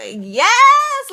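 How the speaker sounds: high, loud cheer; retold whoop of relief; jubilant yes. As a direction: celebrate like you just got free. Creepy man finally checked out.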